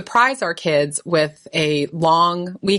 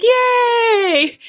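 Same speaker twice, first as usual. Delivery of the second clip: put-on kid's cheer; 4 dB louder than usual. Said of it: "And Stephen was like".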